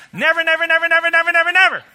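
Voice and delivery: put-on loud high scold like to children; scolding kids. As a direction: tell the kids never, firmly. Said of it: "pretend like you're talking to your kids".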